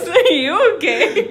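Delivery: crying with laughter; words squeezed out through laughter; laughing hard. Real, not acted. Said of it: "I'm laughing so hard, I'm crying".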